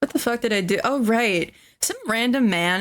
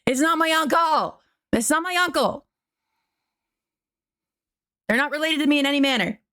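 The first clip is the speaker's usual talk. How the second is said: higher, emphatic protest; insistent denial; 6 semitones higher, faster than usual. Real, not acted. Streamer to chat, insisting the Nintendo contact isn't family.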